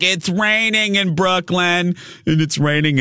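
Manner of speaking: a hint of the screechy caller voice; screechy Marianne. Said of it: "a really screechy voice".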